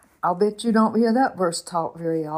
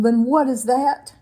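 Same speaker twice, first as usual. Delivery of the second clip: sharp, louder retort, pitched higher; blasting out; 5 semitones higher, 4 dB louder than usual. Retold or imitated. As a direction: snap back at her husband in alarm. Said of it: "blast out at him".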